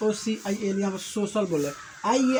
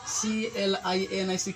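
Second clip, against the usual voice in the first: slow spelling out; slower than usual. Shown as a demonstration. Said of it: "clinic हो गया भैया".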